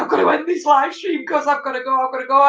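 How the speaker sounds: high-pitched playful panic about needing to go; mock panic. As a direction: imitate a friend desperate to leave. Mimicking friend rushing off stream.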